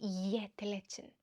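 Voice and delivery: soft, slow Swedish "jätteledsen"; Swedish word. Shown as a demonstration. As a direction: say "jätteledsen" slowly and quietly, the Swedish way. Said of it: "I'm gonna say it in Swedish".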